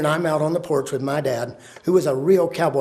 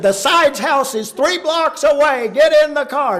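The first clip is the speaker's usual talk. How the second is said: high-pitched insistent holler; urgent father; 10 semitones higher, 4 dB louder than usual. Retold or imitated. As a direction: order the family out in a panic. Father urging family to flee.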